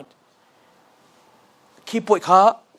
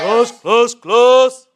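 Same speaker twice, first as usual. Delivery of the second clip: loud call; 4 semitones higher, 11 dB louder, much slower than usual. Acted. Teacher attention call-and-response.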